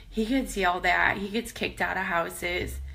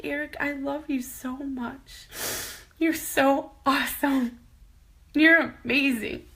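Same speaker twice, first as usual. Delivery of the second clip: tearful; 6 semitones higher than usual. Retold or imitated. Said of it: "And I'm like".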